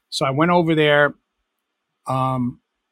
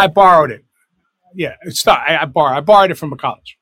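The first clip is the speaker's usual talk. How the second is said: louder, mock-innocent insisting he borrowed it; mock insistence; 2 semitones higher, 8 dB louder than usual. Caught out by the host, loudly insisting he borrowed it. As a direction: play it innocent, pushing borrowed. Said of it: "did you say you borrowed it".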